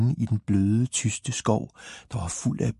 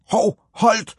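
high, loud little-character yell; sharp high yelp of protest; alarmed shriek; 12 semitones higher, 6 dB louder than usual. A narrator's reading. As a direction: cry out high to stop everything. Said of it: "Skreg Tippetophat".

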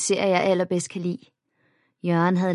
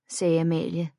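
ordinary delivery

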